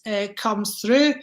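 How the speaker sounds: usual speaking voice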